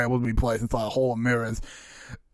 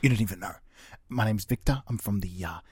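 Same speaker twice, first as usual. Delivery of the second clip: whispering; 5 semitones lower, 9 dB quieter than usual. Acted. Agent whispering.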